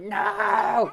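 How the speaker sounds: high, loud cry; shouted no. Acted. Girl fending off a javelina, high shout. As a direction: yell no at the beast.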